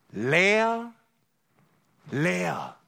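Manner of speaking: a broken sob on "Leer, leer"; sobbing. A narrator's reading. Dying aunt seeing her empty hands, sobbing. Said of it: "schluchzte sie".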